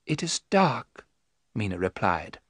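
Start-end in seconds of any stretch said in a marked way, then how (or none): 0.1-1.1 slow, distant, entranced; slow and clear. Acted. Mina answering under hypnosis, slow and clear. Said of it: "Her voice was slow and clear".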